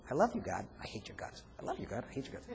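hushed, near-whispered two-faced prayer; hushed two-faced hypocrite praying to God. Acted. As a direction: murmur love then hate under your breath. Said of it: "he'll be two-faced".

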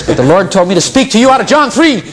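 high-pitched imitation of a pushy believer; mock zealous pronouncement. Believer springing a rebuke on a newly met brother, mock zealous pronouncement. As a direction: announce it high, mocking a self-appointed messenger.

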